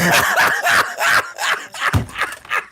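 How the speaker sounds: high, breathless cackle; helpless laugh tailing into wheezes; real laughter. Real, not acted. Streamer cracks up at being called drug dealer, wheezing laughter.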